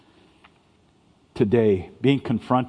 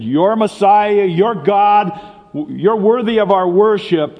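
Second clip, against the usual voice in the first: much higher and louder, slow at first; fervent praise; 9 semitones higher, 6 dB louder than usual. Retold or imitated. Peter once hailing Jesus as Messiah, high, loud and fervent. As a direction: proclaim it in awe. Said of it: "the guy who earlier had said".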